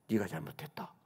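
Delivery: low, soft motherly verdict; quiet rebuke. Retold or imitated. Mother tells her angry son he is wrong, quietly. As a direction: pronounce it quietly and firmly, voice dropped.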